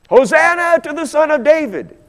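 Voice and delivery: high, loud cry of praise; loud, high-pitched acclaim; crowd's acclamation. Acted. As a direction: call it out like a welcoming crowd. Voicing crowd at triumphal entry.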